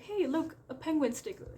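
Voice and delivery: high, loud excited call. Retold or imitated. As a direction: point it out with childlike glee. Friend pointing out a penguin.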